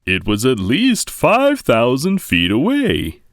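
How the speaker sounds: Papa voice. A narrator's reading. Father bear boasting to his cubs, hearty and loud. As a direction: boom it out like a proud papa bear.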